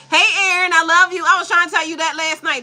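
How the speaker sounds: high, loud and a bit teary; overjoyed hello; excited greeting. Real, not acted. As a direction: gush to a returning friend. Greeting a viewer emotionally.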